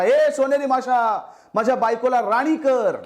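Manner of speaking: very high, slow drawn-out call; fisherman calling out. Acted. Fisherman begs the golden fish for his wife, calling high and slow. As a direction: stretch the call over the sea to the fish.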